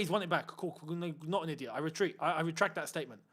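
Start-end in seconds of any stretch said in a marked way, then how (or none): none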